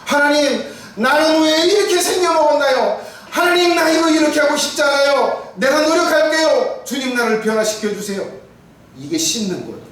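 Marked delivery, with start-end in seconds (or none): 0.1-8.2 high, loud pleading to God; pleading prayer. Acted. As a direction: cry out to God in earnest repentance. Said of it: "acted prayer of repentance". (하나님께 간절히 울부짖는 기도)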